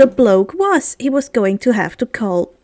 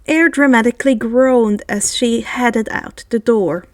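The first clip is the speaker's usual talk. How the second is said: plain reading voice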